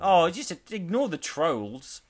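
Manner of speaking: slow, exaggerated posh delivery. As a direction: stretch it out like an aristocrat. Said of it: "you have to go posh to say".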